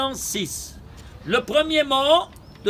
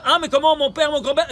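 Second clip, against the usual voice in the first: higher-pitched mock protest; protesting objector; 5 semitones higher, 4 dB louder than usual. An objector defends father and grandfather's custom, protesting. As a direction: act out an indignant objection.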